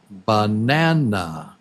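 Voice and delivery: "banana" with the middle NA long and strong; strong second syllable. Shown as a demonstration. Teacher asks which beat is strong, "banana" stressed on its second syllable. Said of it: "Which is the strong syllable?".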